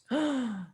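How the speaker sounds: sharp high gasp of awe. Real, not acted. Stunned by the carving, a sharp gasp. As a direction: catch your breath in wonder.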